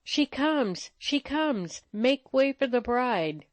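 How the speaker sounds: high-pitched, joyful heralding; heralding cry. A narrator's reading. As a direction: proclaim the bride's arrival, pitched well up. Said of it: "sang a chorus of voices".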